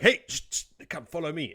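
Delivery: high, urgent whispered summons; urgent hushing Swan. Retold or imitated. Host voicing Swan waving Moraine out, high and urgent. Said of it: "And then she's like".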